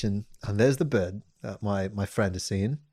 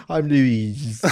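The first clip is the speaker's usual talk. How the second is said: put-on British accent; 3 dB louder than usual. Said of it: "it's Louis in British".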